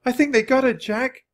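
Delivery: high, distressed wail; high-pitched despair. A narrator's reading. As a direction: wail it to a companion. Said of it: "Annie wailed".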